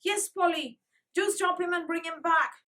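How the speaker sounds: loud, high plea; begging. Acted. Francie begging Polly to fetch Joel. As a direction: beg Polly to bring him back.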